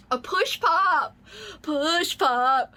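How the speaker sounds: high, loud, slow squeal; real delighted cry; excited. Real, not acted. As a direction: savor the discovery. Finding a push pop.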